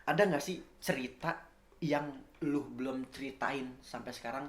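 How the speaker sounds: ordinary talk, nothing marked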